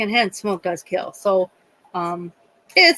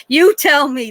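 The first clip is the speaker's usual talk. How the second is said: raised, louder, challenging retort; pushy comeback, pitched up; real attitude; 6 semitones higher, 5 dB louder than usual. Retold or imitated. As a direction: imitate a nurse with an attitude. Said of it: "had a real attitude".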